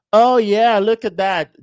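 high, loud excited exclamation; hammed-up online bragging; mock showing off. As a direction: call it out loudly, showing off. Acts out an excited online poster.

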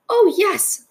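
high, piped-up little voice; tiny character piping up. A narrator's reading. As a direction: pipe it up very high, eagerly. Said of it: "piped the voice".

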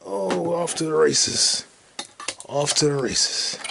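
slow, loud race call; race announcer. Pretend. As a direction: call it like the track. Announcer-style exclamation.

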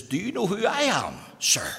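exaggerated posh echo of a haughty question; mimicked posh accent. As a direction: throw the posh accent back at him. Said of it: "with a posh accent".

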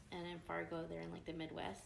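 normal talking voice

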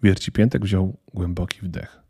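usual speaking voice

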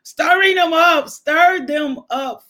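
fiery preacher call; fervent shout. Real, not acted. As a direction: shout it with rising fervor. Preacher rising in fervor.

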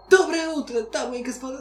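high, held-back attempt at yelling; timid mock shout. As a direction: try to shout but hold back shyly. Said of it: "ты пытаешься кричать, но ты стесняешься". (стеснительная попытка крикнуть)

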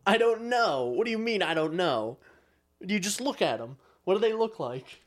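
high, loud incredulous comeback; exasperated disbelief. Acted. Acting out a reply to a clueless caller, exasperated.

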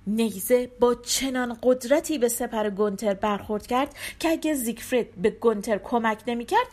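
ordinary delivery, nothing marked

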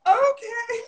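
high, near-tearful squeal of joy; overjoyed squeal. Retold or imitated. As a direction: squeak it like you just won. Offered a free buddy pass.

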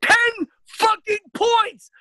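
high, loud, slow outraged shout; outraged yell. Real, not acted. Ranting to friends about the homework app, yelling in outrage. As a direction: bellow the penalty in disbelief.

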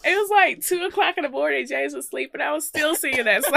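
high, loud telling through laughter; barely holding in real laughter; laughing. Real, not acted. Recounting while cracking up.

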